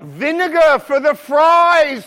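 high, loud, hammed-up delight; exultant shout. Real, not acted. Preacher celebrating a burger place's condiments, exultant shout. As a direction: shout it like glorious news. Exulting over vinegar for fries.

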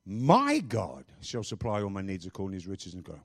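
MY punched at the start; stress on MY. Shown as a demonstration. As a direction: lean hard on "My", then run through the verse low. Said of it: "emphasizing one word at a time".

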